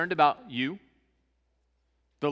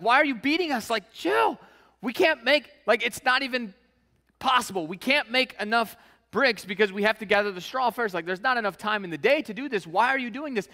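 much higher, pleading and indignant; whiny complaining workers; 7 semitones higher, 3 dB louder than usual. Retold or imitated. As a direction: whine at the boss like overworked foremen. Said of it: "They're like".

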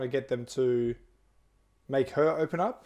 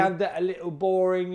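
put-on higher, over-the-top English accent; mock English accent; 4 semitones higher than usual. Host redoing his line in an over-the-top English accent. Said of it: "yours is over the top".

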